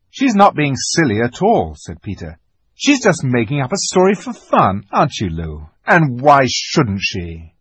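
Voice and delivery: loud, teasing brother; mock the little sister; teasing older boy. A narrator's reading. Peter voiced in story.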